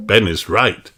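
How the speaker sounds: usual speaking voice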